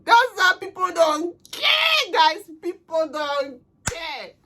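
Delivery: slow, drawn-out high cry of frustration; emphatic outcry. Real, not acted. Telling her viewers that people don't care, in a slow high outcry. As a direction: stretch out each word, pleading with the viewers. Venting to viewers about cruelty.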